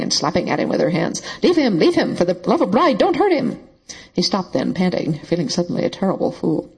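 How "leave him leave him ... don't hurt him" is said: frantic shrieking. A narrator's reading. Woman begging him to stop beating her servant, shrieking. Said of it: "she was shrieking, crying".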